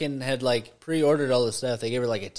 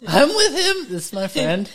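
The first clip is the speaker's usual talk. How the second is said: very high, loud mimicking voice; mock squeaky echo; 9 semitones higher, 5 dB louder, slower than usual. Friend teasing the storyteller about introducing himself, mocking high voice. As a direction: echo him in an exaggerated high pitch.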